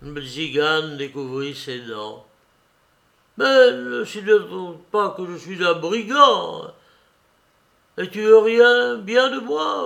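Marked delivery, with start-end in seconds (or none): 3.4-9.8 loud, high, pressing plea; urgent outcry. Acted.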